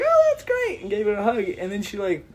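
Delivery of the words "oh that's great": very high falsetto gush; gushing mock delight. Drunk guy answering the girl, gushing mock delight. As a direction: squeal it with exaggerated delight.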